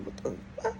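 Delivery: high breathy whisper of disbelief; to herself, stunned as the customer flips out, whispered shock; whispered what the fuck. Retold or imitated. Shocked whisper, half under breath.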